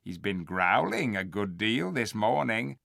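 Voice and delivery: ordinary delivery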